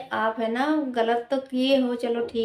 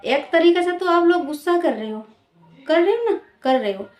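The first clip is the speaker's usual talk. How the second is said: angry scolding; 6 semitones higher, 5 dB louder than usual. Real, not acted. Scolding the media, rising.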